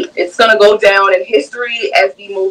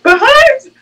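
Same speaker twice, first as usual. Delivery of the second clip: high, louder spooky "Behind you"; mock scary movie warning; 3 dB louder than usual. Shown as a demonstration. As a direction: sing out "Behind you" high like a horror film. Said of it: "It was like a scary movie".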